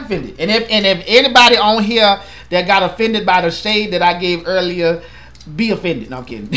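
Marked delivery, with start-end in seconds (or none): none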